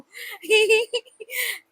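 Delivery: a sudden high giggle at her own mess; high laugh burst. Real, not acted. Laughing at the paratha shaped like a map of India.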